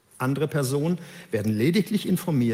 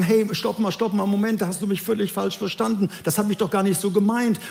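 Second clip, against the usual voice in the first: higher-pitched, acted objection; urgent protest; 6 semitones higher than usual. The absent man protesting he was misunderstood, urgent protest. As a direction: cut in to object, voice raised in pitch.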